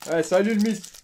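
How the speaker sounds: loud, high happy cheer; excited shout. Real, not acted. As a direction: call out to the card. Greeting a pulled card.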